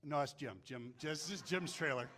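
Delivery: low, small-voiced excuse; meek deflection. Intimidated man answering a monstrous dude, mock meek. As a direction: shrink back and pass the blame.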